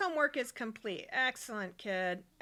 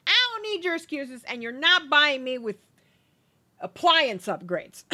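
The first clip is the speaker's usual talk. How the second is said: loud, hammed-up scolding; acted irritation, louder and punchy; mock angry wife; 3 semitones higher, 11 dB louder than usual. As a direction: tell off the husband with playful force. Voicing annoyed wife rejecting husband.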